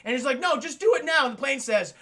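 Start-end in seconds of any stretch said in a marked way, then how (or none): 0.4-1.2 high, loud mock insistence; impatient demand. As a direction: snap it like a panicked passenger. [name] acted demanding a landing.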